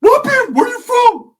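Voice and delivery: very high, loud shout; gang challenge shout. Retold or imitated. Ricky shouting his gang challenge at the bulldogs. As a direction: shout the challenge across the porch. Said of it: "he's like".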